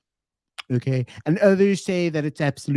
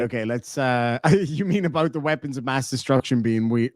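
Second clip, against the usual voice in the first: normal talking voice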